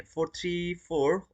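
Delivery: ordinary delivery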